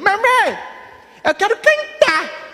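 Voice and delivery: put-on slow, high childish plea; high little boy. Altar boy tells his mom he wants to sing, high child voice. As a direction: stretch it out like a small kid.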